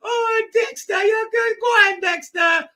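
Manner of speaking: very high, loud squealing woman's voice; flattered woman. Retold or imitated. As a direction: squeal back like a flattered coworker. Woman flirting back, mocked.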